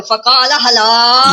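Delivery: حلال stretched out very long; emphatic drawn-out reading. Shown as a demonstration. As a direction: hold the last vowel of حلال, very slowly. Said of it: "ایسے بولے". (حلال کو بہت کھینچ کر)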